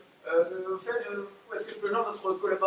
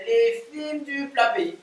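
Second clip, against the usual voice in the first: put-on slow, proud Belgian accent, a touch higher; slow mock Belgian accent; 6 semitones higher, 4 dB louder, slower than usual. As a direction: savour the fake Belgian company name. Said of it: "je prends l'accent belge".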